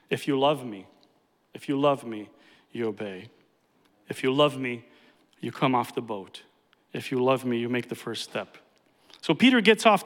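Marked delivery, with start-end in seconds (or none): 0.1-8.6 lower, softer, intimate call; tender plea. Acted. Preacher voicing Jesus calling his followers, low and tender. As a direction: speak it as a loving invitation.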